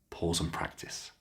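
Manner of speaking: whispered aside to learners; hushed reminder under the lesson; whispered cue. Shown as a demonstration. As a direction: whisper the instruction softly.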